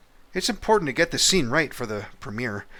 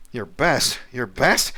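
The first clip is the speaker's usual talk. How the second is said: incredulous; 3 semitones higher, 9 dB louder, slower than usual. Pretend. Director incredulous at actor.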